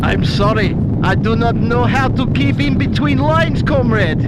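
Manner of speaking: put-on loud comic Russian accent. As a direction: apologise as a Russian comrade, loudly. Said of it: "Get your Russian accent on".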